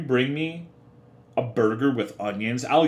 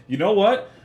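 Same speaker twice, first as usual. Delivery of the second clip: mock indignant; 8 semitones higher, 4 dB louder than usual. Announcing revenge on chat.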